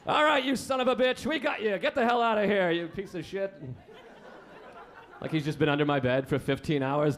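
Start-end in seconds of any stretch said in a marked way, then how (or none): 0.1-3.5 high-pitched, hammed-up threat; mock tough confrontation. Boyfriend bouncing a psychopath from hotel room, mock angry threat. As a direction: badly bluff your way through evicting an intruder.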